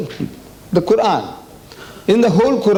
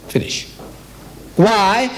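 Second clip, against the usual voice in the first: ordinary talk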